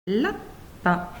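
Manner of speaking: "Lapin" split as La... pin; long pause inside "Lapin", after La; syllable by syllable. Shown as a demonstration. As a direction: sound out "Lapin" in two separate syllables. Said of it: "il y a deux syllabes".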